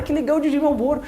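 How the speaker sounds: mock delight. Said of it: "Eu falei".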